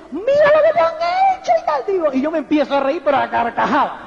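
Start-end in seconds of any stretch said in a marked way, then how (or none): 0.1-1.8 much higher and louder, a shocked cry over the scratched car; alarmed dealer. Retold or imitated. The car dealer showing the speaker the scratch, high and louder in alarm. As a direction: squeal it like someone horrified at the damage. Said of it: "el distribuidor se pone y dice".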